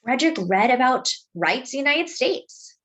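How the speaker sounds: plain reading voice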